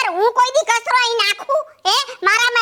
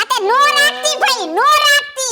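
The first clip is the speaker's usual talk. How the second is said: raised, higher-pitched protest; heated, higher-pitched denial; indignant retort; 5 semitones higher than usual. Acted. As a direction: throw it back sharply in a quarrel. Indignant retort in acted quarrel.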